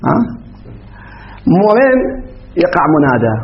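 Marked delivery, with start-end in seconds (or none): none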